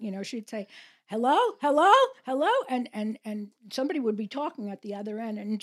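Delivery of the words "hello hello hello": put-on higher, louder Russian-accented phone greeting. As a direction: imitate grandmother's Russian accent, calling into the phone. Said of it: "with her Russian accent".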